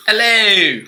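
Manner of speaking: hammed-up Australian hello; mock Australian. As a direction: call it out like an Australian croc hunter. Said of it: "turn into the Crocodile Hunter or Crocodile Dundee".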